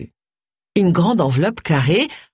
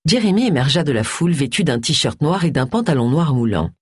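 plain reading voice

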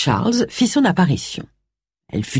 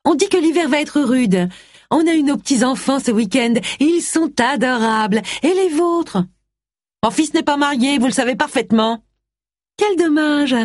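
higher, prying neighbour's chatter; nosy neighbour; 10 semitones higher than usual. A narrator's reading. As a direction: needle the neighbour with sweetness. Neighbour and Mrs Wong exchange. (voisine indiscrète, voix plus aiguë)

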